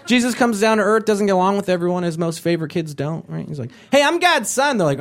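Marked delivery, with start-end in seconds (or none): 3.9-4.8 smug boast. Retold or imitated. Jesus boasting.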